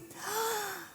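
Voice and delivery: sharp high intake of breath in horror; high shocked gasp. Retold or imitated. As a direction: gasp at the ruined wall. Daughter sees scribbled wall.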